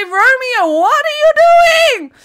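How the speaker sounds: rising, high, loud outburst; excited disbelief. Real, not acted. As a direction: climb to an incredulous yell. Reacts to more donations.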